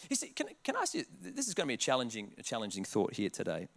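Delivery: low, soft lead-in; hushed aside. Real, not acted. Preacher preparing the church for a challenge, hushed. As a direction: drop the voice to draw them in.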